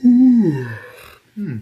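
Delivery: big high sleepy yawn. Acted. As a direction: let out a long loud yawn. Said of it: "Toya then gives out a yawn".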